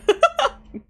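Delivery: high burst of laughter; loud laugh. Real, not acted. Player cracking up at the mailman leaving, loud laugh. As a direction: let a big laugh out.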